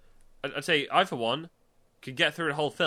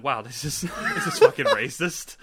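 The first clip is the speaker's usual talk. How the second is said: laughing; 3 semitones higher, 6 dB louder than usual. Retold or imitated. Mock reaction while laughing.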